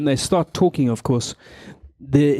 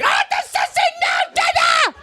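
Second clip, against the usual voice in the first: put-on very high shouted child's whine; whiny toddler demand. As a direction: screech it like an impatient toddler. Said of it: "of course, they want it now".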